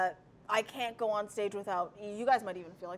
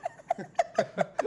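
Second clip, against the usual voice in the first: sudden high laugh; high burst of laughter; 3 dB louder than usual. Real, not acted. Laughs at the joke.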